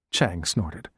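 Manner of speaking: ordinary delivery, nothing marked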